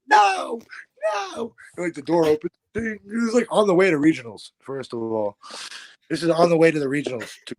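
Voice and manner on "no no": very high mock dad squeal; dad panicking. Dad yelping as the punch comes, high squeal. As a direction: yelp it like a frightened dad. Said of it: "And my dad's like".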